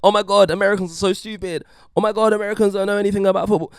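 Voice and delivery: high, rushed mocking voice; hammed-up gleeful scoffing at someone's stupidity; mocking ridicule. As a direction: gush it fast in a high, jeering pitch. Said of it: "this episode isn't me sat up here going".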